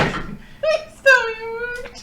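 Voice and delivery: high, drawn-out laughing plea; long pleading wail through laughter. Real, not acted. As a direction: beg in a stretched, high whine. Player begs for the spell to work.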